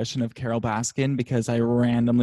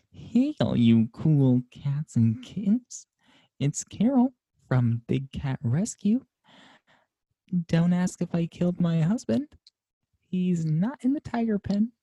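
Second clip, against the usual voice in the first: slow, drawn-out higher voice, rising as it goes; Carole Baskin impression; 7 semitones higher, slower than usual. Host doing a Carole Baskin bit for the podcast, slow and drawn out. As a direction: purr it out like a big-cat rescuer. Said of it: "do our best Carole Baskin impression".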